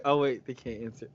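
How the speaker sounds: ordinary talk, nothing marked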